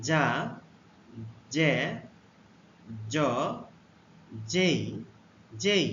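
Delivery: যা, জে, জ, যেই said one by one, slow and clear; teacher modelling যা জে জ যেই; letter sounds demo. Shown as a demonstration. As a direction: model যা জে জ যেই with a pause after each. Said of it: "জে এর উচ্চারণ হবে".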